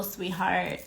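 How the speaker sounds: ordinary talk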